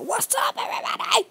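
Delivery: high, breathy, whispered shout-out; squeaky pretend host hello; hammed-up airy welcome; mock hype greeting. [name] greeting his audience.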